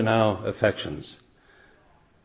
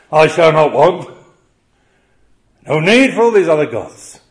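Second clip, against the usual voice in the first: rising, louder preacher's climax; full-force preaching; 6 semitones higher, 7 dB louder than usual. Acted. Preacher proclaiming God as Israel's shepherd, loud and soaring. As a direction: build the shepherd lines into a ringing proclamation.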